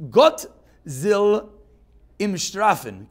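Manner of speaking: a hint of bad German, "Gott" called out loud; terrible German. Shown as a demonstration. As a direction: mangle the German on purpose. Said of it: "now you can hear my terrible German".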